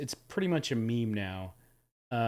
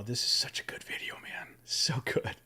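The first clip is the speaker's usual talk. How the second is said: whisper; 3 semitones lower, 4 dB quieter than usual. Real, not acted. Hushed aside about favorite video.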